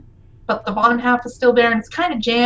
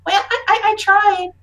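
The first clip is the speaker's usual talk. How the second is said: put-on high, halting kid voice, growing louder; sheepish child; 7 semitones higher, 4 dB louder than usual. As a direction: stammer as a child just told off, then ask. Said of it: "And she's like".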